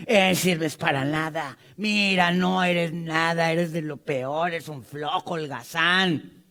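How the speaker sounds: slow, a hint of a scornful father; belittling father. Preacher imitates a father insulting his son, mild scorn. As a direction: drag out the insults, mildly contemptuous. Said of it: "la provocación habitual de los hijos".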